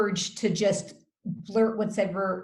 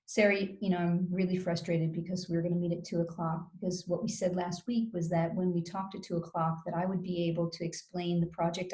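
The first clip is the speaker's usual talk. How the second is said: rambling run-on; 3 semitones lower, 6 dB quieter than usual. Shown as a demonstration. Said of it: "So I don't say".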